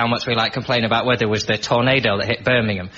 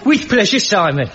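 broad Brummie vowels, pitched up and louder; Birmingham accent; 9 semitones higher, 4 dB louder than usual. Shown as a demonstration. Showing off his Brummie accent for the host. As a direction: lay the Birmingham accent on thick. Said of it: "give us your Birmingham accent again".

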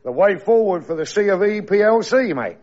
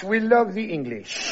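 slow bland repeat; 3 semitones higher, 5 dB quieter, slower than usual. Acted. Interpreter repeats calmly.

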